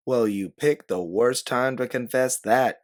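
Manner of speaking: yelled retort. A narrator's reading. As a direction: yell it back at her. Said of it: "you yell".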